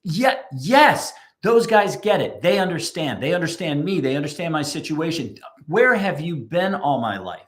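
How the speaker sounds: delighted customer gushing with relief; emphatic hell yes. Retold or imitated. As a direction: burst out like a client who finally feels understood. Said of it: "the emphatic hell yes".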